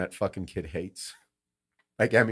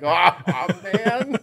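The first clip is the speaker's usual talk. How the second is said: loud, high acted-out "oh man"; mock exclamation; 10 semitones higher, 9 dB louder than usual. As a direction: play up a big, high reaction. Retold gleeful reaction to the kid.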